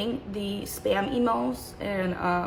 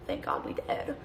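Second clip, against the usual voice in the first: usual speaking voice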